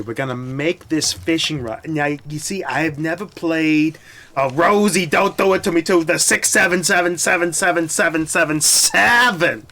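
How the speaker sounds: exasperated shout building into a chant; exasperated rising shout. Real, not acted. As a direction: pitch and volume climb to a shout. Chat spams six seven.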